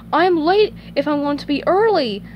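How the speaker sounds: high cartoon voice, mildly urgent; SpongeBob fretting. Worried about getting to work early, high fretting voice. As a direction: raise the pitch with swooping emphasis. Realises he is late.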